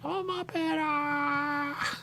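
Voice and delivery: very high squealed puppet cry; a hammed-up Muppet squeal; Muppet impression. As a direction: shriek it like an excited Muppet. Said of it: "a person saying".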